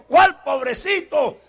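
slow, loud scornful retort; indignant shout. Acted. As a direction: throw the word back at them. Preacher rejects the pity.

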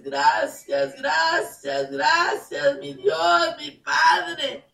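high, loud, tearful praise; crying thanks. Acted. Believer weeping thanks to the Father, crying. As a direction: sob out your gratitude to God. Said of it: "y dices".

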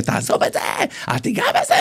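mock frantic yells from scandalized worshippers; retold yelling. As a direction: imitate a crowd's strained, high warning cry. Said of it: "התחילו לצעוק לי". (חיקוי צעקות אזהרה מבוהלות)

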